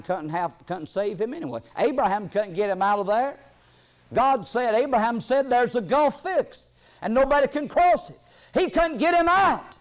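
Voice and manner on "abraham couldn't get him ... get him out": full-force preaching. Acted. Preacher telling congregation nobody can cross the gulf, full-force preaching. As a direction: build to a shouted, emphatic close.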